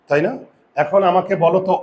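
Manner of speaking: normal talking voice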